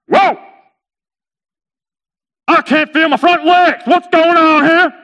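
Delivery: high, loud comic alarm; alarmed cry. Acted. Camel waking with numb front legs, alarmed. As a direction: cry out in hammed-up panic.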